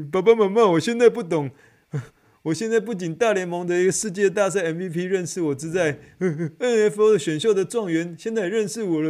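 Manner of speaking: giddy bragging. Real, not acted. Telling mom and dad about meeting the top pick, giddy. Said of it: "overjoyed bragging to parents".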